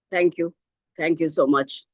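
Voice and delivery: ordinary talk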